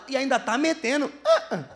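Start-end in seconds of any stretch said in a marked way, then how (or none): none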